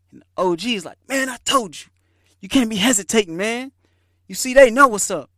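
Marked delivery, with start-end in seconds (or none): 1.1-5.3 higher and louder, cocky know-it-all; boastful OG. Retold or imitated. OG schooling the teller about hesitating, loud and cocky. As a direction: brag like the old head who was right.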